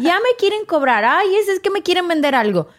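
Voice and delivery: mock complaining about callers trying to sell her something; exasperated grumble. Mocking annoyance at sales calls.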